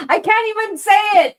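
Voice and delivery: high, loud, embarrassed cry; flustered outburst. Real, not acted. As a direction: blurt it out, too flustered to finish.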